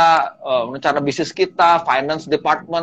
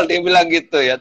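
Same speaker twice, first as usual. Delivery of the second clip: ordinary talk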